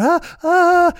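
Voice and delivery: high, loud mock shrieks; imitated panicked screams. Safari people with an owl on the dashboard, mock screaming. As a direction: scream as if freaking out, hammed-up. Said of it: "they start screaming".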